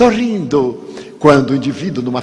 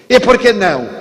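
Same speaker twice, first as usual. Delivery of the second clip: high, louder, curt stranger; sharp stranger; 7 semitones higher, 4 dB louder, faster than usual. Retold or imitated. Stranger in the queue firing back, higher and louder. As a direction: snap the question back.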